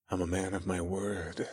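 very soft, intimate tease; quiet playful boast; teasing. A narrator's reading. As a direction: say it hushed and playful. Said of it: "I teased Gemma".

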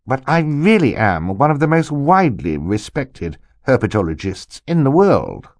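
high, self-important character voice; braggy uncle. Acted. Uncle boasts of his standing among herpetologists, braggy. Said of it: "which here means braggy".

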